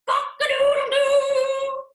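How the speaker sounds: slow, loud, high crowing; rooster crow. Acted.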